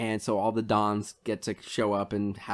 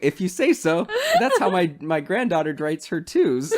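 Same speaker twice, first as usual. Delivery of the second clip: louder, higher, laughing; humoring him through laughter; laughing old man; 6 semitones higher, 5 dB louder than usual. Retold or imitated. Old man humors Zorro, laughing. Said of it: "He go".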